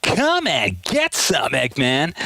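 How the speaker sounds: Sonic voice. Pretend. Hero taunting the villain, cocky and slow. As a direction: dare him to come at you. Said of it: "And then Sonic goes".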